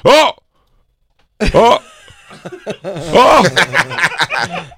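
high, loud pained yelps; imitated yelps. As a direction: yelp it out like it just hit you. Ralph yelps as plug hits.

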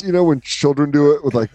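ordinary talk, nothing marked

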